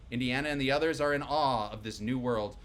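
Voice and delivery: usual speaking voice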